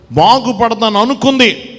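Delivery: loud, ringing preacher's call, slowing on the last words; preaching at full force. Acted. Preacher calling out loudly to the congregation about the sick woman.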